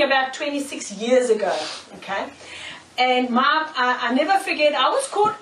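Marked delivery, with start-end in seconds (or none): none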